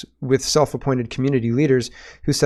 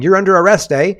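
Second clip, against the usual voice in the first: mock police officer; 7 semitones higher, 8 dB louder than usual. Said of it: "standing with her hands in her pockets saying".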